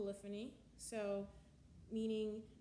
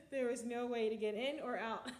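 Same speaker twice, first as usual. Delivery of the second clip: louder, words laughed through; laughing; 5 dB louder than usual. Real, not acted. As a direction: say it still laughing at the maze. Laughing at the impossible maze.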